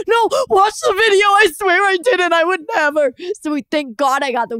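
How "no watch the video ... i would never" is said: high, loud pleading shout; frantic denial. Retold or imitated. Insisting to the partner she never talked back, loud and high. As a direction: insist desperately, higher and louder.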